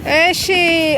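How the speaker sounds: ordinary talk, nothing marked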